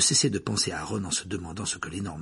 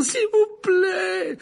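desperate plea; 9 dB louder, much slower than usual. Acted. Hermione begs for help.

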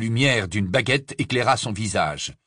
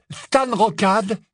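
strained lie; 11 semitones higher, much slower than usual. Acted. Ron lies under duress.

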